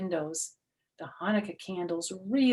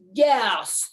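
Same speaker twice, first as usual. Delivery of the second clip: grumbling goblin; 4 semitones higher, 9 dB louder than usual. Acted. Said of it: "the goblin grumbled".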